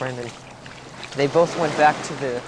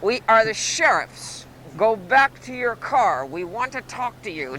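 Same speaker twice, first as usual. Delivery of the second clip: booming police announcement, pitched up; Broderick Crawford; 6 semitones higher than usual. Acted. Sheriff calling to suspects from above, stretched-out official hail. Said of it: "comes old Broderick's voice saying".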